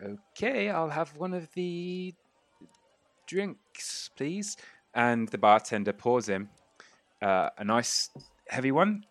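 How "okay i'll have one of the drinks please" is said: high, small, hesitant voice; nervous, halting politeness; reluctant order. Acted. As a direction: order meekly, pitched up high. Half-orc reluctantly orders the drink.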